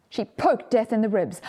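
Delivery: ordinary delivery, nothing marked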